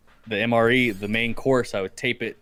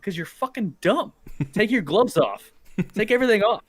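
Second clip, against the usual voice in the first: higher and louder, mock exasperated; mocking advice; 10 semitones higher, 3 dB louder than usual. Tanned soldier scolding pale comrades, higher exasperated mockery. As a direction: scold them, high and loud, like it's obvious.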